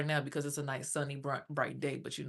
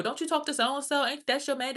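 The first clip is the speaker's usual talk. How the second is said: high, louder imitation of busybodies; high needling questions; mock nosy strangers; 9 semitones higher, 4 dB louder than usual. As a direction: mimic a prying stranger, pitched up. Imitating grocery store strangers.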